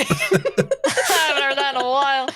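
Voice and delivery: laughing through the words. Real, not acted. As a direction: repeat it while cracking up. Same line repeated, second laughing.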